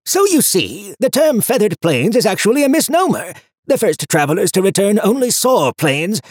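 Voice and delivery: high, loud pedantic book voice; fussy. A narrator's reading. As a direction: lecture like a prissy know-it-all. Harriman the book lectures.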